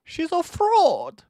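high, loud and slow; shocked outburst. Retold or imitated. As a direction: cry out in disbelief. Shock at seeing the girl.

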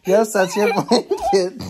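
slow, laughing through tickles; breathless giggling while tickled; being tickled. Real, not acted. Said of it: "Stop tickling me".

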